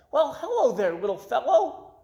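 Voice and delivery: high, bright fish voice calling out; cheerful greeting. Acted. Goldfish Gilbert cheerfully greets a fly. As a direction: call out warmly to the fly. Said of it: "called out cheerfully".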